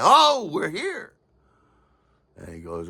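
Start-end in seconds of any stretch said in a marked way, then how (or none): 0.0-1.1 delighted arrival. Retold or imitated. Arriving home by cab.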